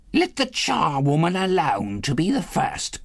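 high, crowing woman's voice; charwoman crying out. A narrator's reading. The charwoman crying to old Joe, high and crowing. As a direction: crow it out in triumph. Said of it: "cried she who had entered first".

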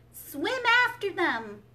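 high, loud, slowed storybook scream; screaming command. A narrator's reading. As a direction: scream it out as an urgent order. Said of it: "screamed the Gryphon".

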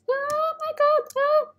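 very high squealing cries; stressed panic. Real, not acted. Player stressed by the escape game, panicked squeal. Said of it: "This is so stressful".

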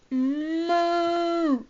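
long, held mock moo; drawn-out imitation moo; cow moo. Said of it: "George went".

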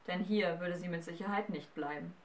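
ordinary delivery

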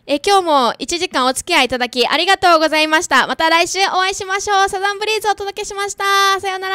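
fast, high, hurried goodbye; rushed sign-off. Real, not acted. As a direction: race through the outro.

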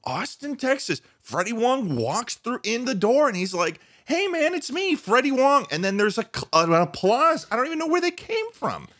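high, excited storybook voice; hammed-up fan fantasy. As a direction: tell it like a giddy child's dream. Mock fantasy of Freddie's arrival.

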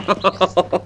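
a light laugh after the joke; short amused laugh. Acted. Man laughing at his joke about the pony, light laugh. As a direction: laugh at your own quip.

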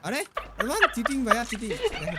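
high outraged cry. Real, not acted. Accusing enemy of cheating. (উত্তেজিত প্রতিবাদ)

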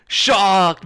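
loud, drawn-out "şaak"; imitated loud smack. Describing the hair strike to viewers, loud imitated smack. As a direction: punch out the crack of a whip-like hit. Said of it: "Öyle bir ses çıktı ki".

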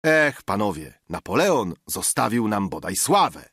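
usual speaking voice

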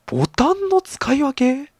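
high, slow baffled question on ボタンの; surprised disbelief; puzzled rising question. Real, not acted. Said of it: "なんか攻撃カードの使い分けとかでもなく".

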